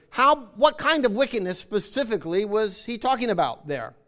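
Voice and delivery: ordinary talk, nothing marked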